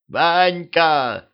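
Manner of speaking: narrator's modest shout; house-spirit's cry. A narrator's reading. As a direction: call out to a friend, lightly acted. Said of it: "закричал домовой".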